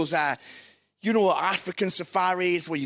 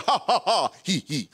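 light imitation of a driver's fixed laugh, three ha's then two he's; imitating John's laugh. Preacher imitating his safari driver's laugh, mild mock laugh. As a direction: lightly mimic the laugh, falling off at the end. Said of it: "he'd be like".

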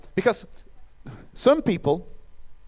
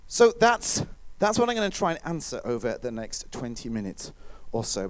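normal talking voice

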